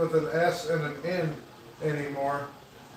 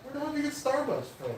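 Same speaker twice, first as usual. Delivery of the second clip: repeated much higher and quicker; higher, faster repeat; 9 semitones higher, 5 dB quieter, faster than usual. Real, not acted. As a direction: say it again, pitched way up and rushed.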